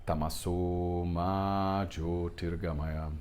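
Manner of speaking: slow Sanskrit chant, Jyotir's long o held; mantra recitation. Shown as a demonstration. Teacher chants the second line for students. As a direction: recite Tamaso Ma Jyotirgamaya slowly and evenly. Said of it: "na segunda frase".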